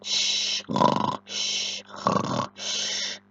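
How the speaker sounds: unvoiced soft shh like sleep breathing; soft shushing snore. Shown as a demonstration. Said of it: "you can go".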